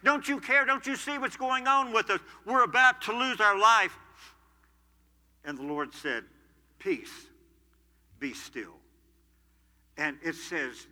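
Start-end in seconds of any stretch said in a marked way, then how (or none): 0.0-3.9 much higher and louder, a panicked plea; desperate crying out. Retold or imitated. Disciples in the storm crying out to the Lord, panicked. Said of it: "they're just crying out". 6.8-8.8 calm command. Retold or imitated. Lord calms the storm.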